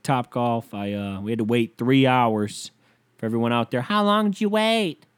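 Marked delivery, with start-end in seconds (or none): 3.8-5.1 mocking nosy question. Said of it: "quoting people again".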